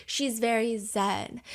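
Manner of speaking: ordinary delivery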